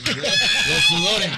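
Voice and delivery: laughing, high and loud; laughing shout. Real, not acted. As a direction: burst out laughing at the memory. Nervous retelling, laughing shout. (risa a gritos)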